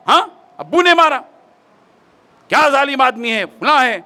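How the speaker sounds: a son flaring up at his father's cruelty; angry son. Retold or imitated. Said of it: "खूब गुस्सा करके". (गुस्से में भड़कता बेटा)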